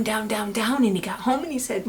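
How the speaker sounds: ordinary delivery, nothing marked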